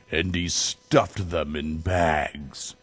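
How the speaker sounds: ordinary delivery, nothing marked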